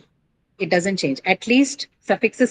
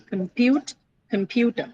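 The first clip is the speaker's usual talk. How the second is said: "Compute, computer" with "pu" stressed in both; "Compute, computer" pitched higher, stress held on "pu"; same stress in both; 4 semitones higher than usual. Shown as a demonstration. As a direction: demonstrate "Compute, computer", second syllable strong each time. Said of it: "it's going to remain like this".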